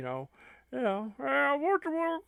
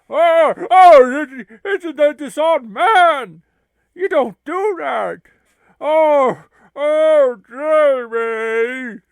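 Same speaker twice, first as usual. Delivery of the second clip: loud, higher panicked "oh no"; alarmed cry; 7 semitones higher, 17 dB louder, much slower than usual. Acted. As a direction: wail in horror at the news. Curly Joe panics.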